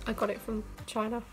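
normal talking voice

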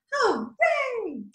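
hooray leaps high; cheering hooray. Shown as a demonstration. Song shout hooray.

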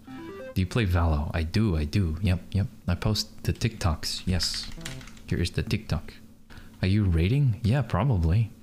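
ordinary delivery

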